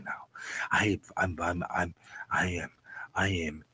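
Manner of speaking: low, soft, self-soothing voice; nice and calm. Pretend. Streamer trying to get rid of the jitters, deliberately calm. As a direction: keep it hushed and calm, stretching out nice. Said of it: "I'm keeping myself nice and calm".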